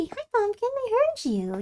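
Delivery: high, soft cooing voice. Real, not acted. Cooing to the cat.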